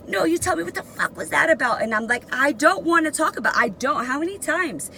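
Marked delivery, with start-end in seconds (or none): 0.1-1.8 high, fast, demanding; mock angry yell. Guard interrogates cornered inmate, imitated angry yell. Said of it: "she's just yelling". 2.3-4.9 high, fast, exasperated refusal; fed-up attitude. Retold or imitated. Inmate refuses the guard's repeated question, retold exasperation. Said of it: "still had an attitude".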